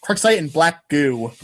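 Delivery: normal talking voice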